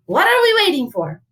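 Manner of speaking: high, loud rallying question; eager hero. Acted. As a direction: urge the gang onward. Hero rallies his amigos.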